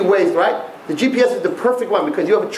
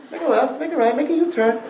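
higher-pitched, even navigation voice; unflustered GPS voice; 6 semitones higher than usual. Acted. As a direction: recite directions like an unbothered machine. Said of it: "the machine doesn't lose its cool".

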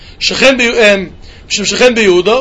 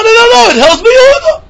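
emphatic shout; 13 semitones higher, 5 dB louder than usual. Acted. Emphatic rejection of earlier reading.

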